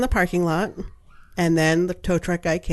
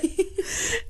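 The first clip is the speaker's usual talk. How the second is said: high squeal of a laugh; real incredulous high laugh; high squeaky laugh; 13 semitones higher, 7 dB quieter than usual. Real, not acted. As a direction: burst into a squeaky giggle. Laughing at tiny car.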